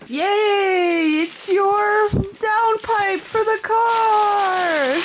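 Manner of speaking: high, slow, gleeful squeal; excited cheer. Real, not acted. Wife cheering as husband unwraps his birthday present. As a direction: cheer him on like it's the best gift.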